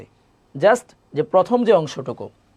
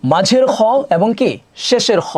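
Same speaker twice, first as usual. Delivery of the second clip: normal talking voice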